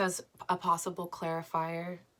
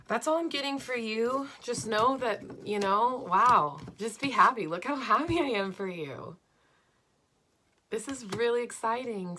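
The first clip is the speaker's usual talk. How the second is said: excited; 4 semitones higher, 6 dB louder than usual. Real, not acted. Thrilled by the reading's message.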